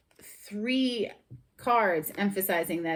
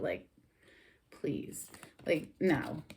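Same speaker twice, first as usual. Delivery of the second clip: dismissive whisper; 3 semitones lower, 6 dB quieter, slower than usual. Real, not acted. Dismissing the returning ex.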